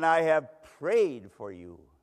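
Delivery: loud, slow, weighty declaration; emphatic assurance. Acted. Jesus assuring Simon before his fall, emphatic and slow. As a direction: declare it firmly, stretching the words out.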